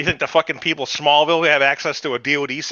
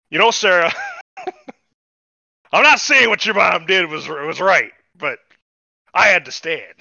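raised, louder mock comic bit; mock Chris Rock delivery; 6 semitones higher, 4 dB louder than usual. Retelling the dad's excuse to Sarah, mock comic bit. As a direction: ham up the punchline like a stand-up. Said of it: "That's the whole Chris Rock skit".

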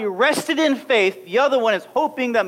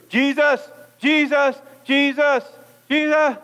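nagging calling; 4 semitones higher than usual. Acted. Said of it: "Maybe we can go".

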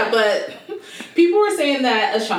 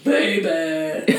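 mock singer; 9 semitones lower than usual. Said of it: "I was just kinda like".

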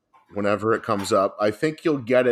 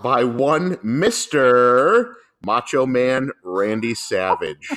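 slow, louder build-up, dropping on the last word; grand announcer reveal; 4 dB louder, much slower than usual. Real, not acted. Revealing the album to a co-host, drawn-out announcer style. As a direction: draw out the name like a ring announcer.

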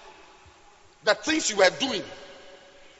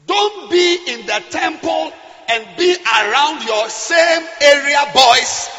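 loud fiery sermon; preaching; 3 semitones higher, 7 dB louder than usual. Acted. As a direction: preach it with full force. Preaching at full force.

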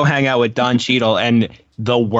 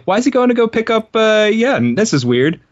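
higher-pitched mock bewilderment giving way to acceptance; puzzled viewer; 8 semitones higher than usual. Viewer puzzling over a movie scene, mildly exaggerated confusion. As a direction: replay your baffled running commentary, lightly amused.

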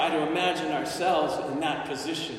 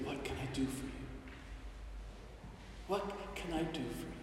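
much softer, close in the ear; whispering Jesus; 4 semitones lower, 17 dB quieter, much faster than usual. Acted. Jesus whispering to the blind man, very soft and tender. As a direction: breathe it gently into his ear. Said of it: "whispering in his ears".

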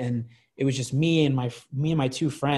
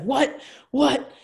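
mock drunken yell; 12 semitones higher than usual. Drunken rage, retold.

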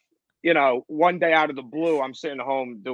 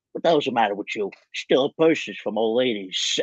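Tony Soprano impression; 3 semitones lower than usual. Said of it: "I saw him in the voiceover studio going".

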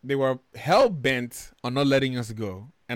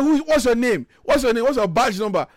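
high, loud interrogation acted out; retold shouting; 12 semitones higher, 7 dB louder than usual. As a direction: demand it at full indignant pitch. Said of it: "So I started shouting".

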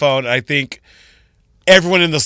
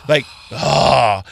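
ordinary talk

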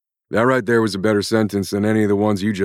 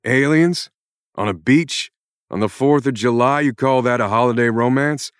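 higher and louder, shouting curses at the author; furious rant; 4 semitones higher, 6 dB louder than usual. Acted. Jack Irons yells at the writer who created him, furious. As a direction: let rip at her like a fed-up hero. Character breaks frame, rants at author.

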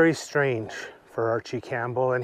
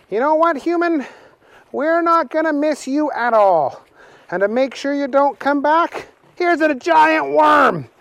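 much higher, mocking character voice; teasing Willie; 11 semitones higher than usual. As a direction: gloat over the parting gift. Willie taunts Archie.

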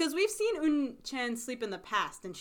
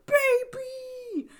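put-on high excited cry of baby; excited shout; 8 dB louder than usual. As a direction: squeal it out high. Everyone excited about the baby.

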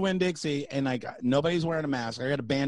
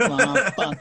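mock panic; 7 semitones higher, 5 dB louder than usual. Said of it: "You're like".

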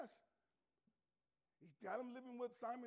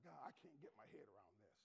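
perplexed mutter; 18 dB quieter, much faster than usual. Acted. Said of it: "He's like".

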